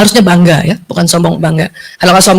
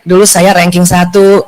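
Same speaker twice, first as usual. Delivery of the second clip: modest boast; 6 semitones lower, slower than usual. Retold or imitated.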